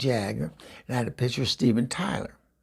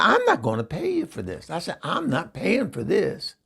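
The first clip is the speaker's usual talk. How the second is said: higher, firm refusal to pay; indignant refusal; 6 semitones higher than usual. Retold or imitated. Eunice refuses to pay the tattoo man, indignant. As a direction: dig in your heels.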